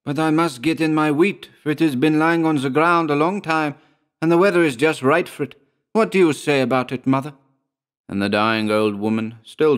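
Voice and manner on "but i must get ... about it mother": distressed plea. A narrator's reading. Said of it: "in great distress".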